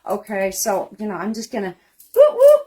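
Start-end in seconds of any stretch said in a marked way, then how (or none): 2.2-2.6 high, loud whooping; celebratory whoop. Real, not acted. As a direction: whoop in triumph. Celebrating her boxes.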